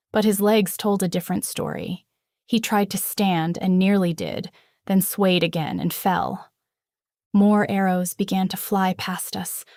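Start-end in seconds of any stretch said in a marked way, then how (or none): none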